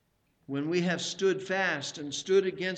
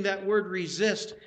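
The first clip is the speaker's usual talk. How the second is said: ordinary delivery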